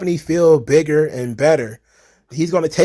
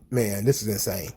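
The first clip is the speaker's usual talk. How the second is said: low and soft, sighing it out; deflated disbelief under the breath; weary sigh; 9 semitones lower, 10 dB quieter than usual. Real, not acted. Sighs at the comments.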